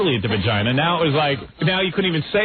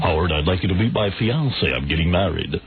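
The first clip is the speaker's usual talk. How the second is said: lower, booming announcer voice; mock deep-voiced announcer; 7 semitones lower than usual. Announcer telling Howard about his fiancée, deep booming voice. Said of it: "that deep voice guy".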